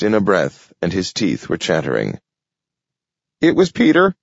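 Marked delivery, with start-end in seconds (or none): 3.4-4.1 high breathless critter voice; squirrel voice. Acted. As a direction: blurt it out like a scared squirrel. Squirrel voice, teeth chattering.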